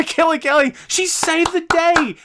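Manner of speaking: high, laughing mock praise; mocking sarcasm. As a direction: sneer it up high through a laugh. Mocking a wrestling booking choice.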